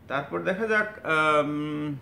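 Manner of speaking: normal talking voice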